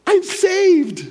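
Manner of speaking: very high, loud beaming news; joyful announcement. Retold or imitated. Coworker tells him she converted, very high joyful. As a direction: burst out the good news. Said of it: "And she goes".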